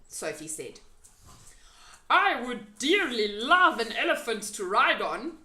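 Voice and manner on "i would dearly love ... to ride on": loud, folksy friendly giant. A narrator's reading. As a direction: play the big kind giant. BFG's giant voice held.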